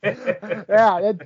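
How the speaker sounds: laughing. Real, not acted. Laughing at co-host's accent.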